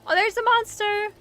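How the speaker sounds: high, loud startled exclamation; yelping at the monster on screen; startled cry. Real, not acted. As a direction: jump at the sight of the monster.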